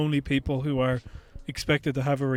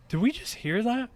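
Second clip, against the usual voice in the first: much higher, hushed question of disbelief; stunned disbelief; 9 semitones higher, 3 dB quieter than usual. Retold or imitated. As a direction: ask it as if you can't believe your ears. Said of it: "we kinda had this feeling of".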